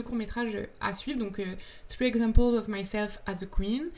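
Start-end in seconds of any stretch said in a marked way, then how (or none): 1.9-3.9 English title said with a French accent. Real, not acted. Guest naming a short film title, French-accented English. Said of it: "désolée pour mon accent terrible".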